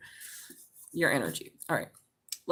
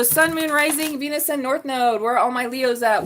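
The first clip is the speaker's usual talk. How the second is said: ordinary talk, nothing marked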